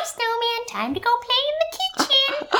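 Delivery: put-on very high bossy little girl; bossy toddler. Mom imitating her daughter bossing the snowman, very high. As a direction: order him around like a tiny boss. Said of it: "bossing Snowman around".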